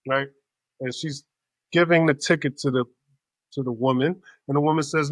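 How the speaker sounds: ordinary talk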